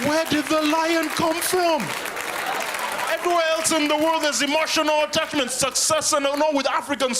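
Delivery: shrill up in outrage at the movie; outraged disbelief. Acted. Comic ranting to audience about the movie, high outraged voice.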